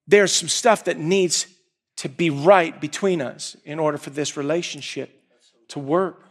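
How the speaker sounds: usual speaking voice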